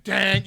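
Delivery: sudden ringing bang; imitated metal clang. Imitating the bottle banging the boat a second time, clang. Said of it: "you just hear it bang again".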